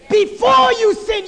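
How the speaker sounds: loud, punched refrain; preaching refrain. Acted. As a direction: hammer it to the congregation.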